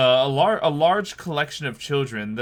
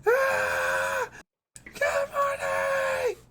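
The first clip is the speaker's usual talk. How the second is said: very high, slow screamed greeting; shrieking children's choir; much slower than usual. Acted. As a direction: scream it like a creepy chorus.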